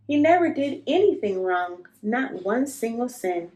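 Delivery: usual speaking voice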